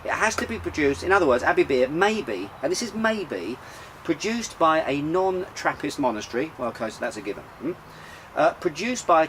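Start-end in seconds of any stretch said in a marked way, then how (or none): none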